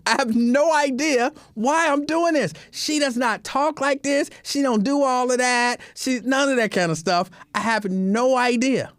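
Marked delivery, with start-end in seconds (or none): none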